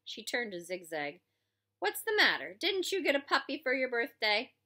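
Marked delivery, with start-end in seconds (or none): 1.8-4.5 higher, mocking question; mock the boy; sarcastic. Warden mocking.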